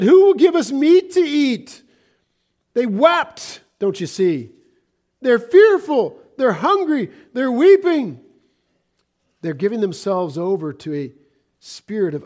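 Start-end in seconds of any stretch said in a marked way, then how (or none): 0.0-1.8 loud, high wailing plea for meat; wailing complaint. Acted. Israelites weeping to Moses for meat, loud wailing plea. 2.7-8.2 loud, high preaching cry; impassioned preaching. Acted. Preacher urging the saints about Israel's fear, impassioned loud preaching. Preacher building to emphatic peak.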